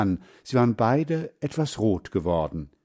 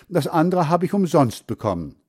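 put-on quick, higher-pitched boyish protest; indignant protest; 6 semitones higher, 4 dB louder, faster than usual. A narrator's reading. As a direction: argue back fast with raised pitch. Cheated boy protests to the vendor. (empörter, schneller Protest)

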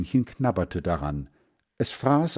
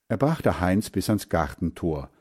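ordinary delivery

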